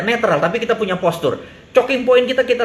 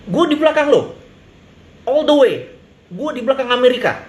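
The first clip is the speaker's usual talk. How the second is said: mock loyal promise; 6 semitones higher than usual.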